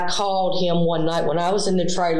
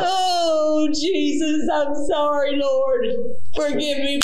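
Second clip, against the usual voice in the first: high, slow sobbing apology, rising to a cry; crying and apologizing; 7 semitones higher than usual. Real, not acted. Apologizing to detectives, sobbing hard. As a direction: wail your sorry through tears. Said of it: "She starts crying and apologizing".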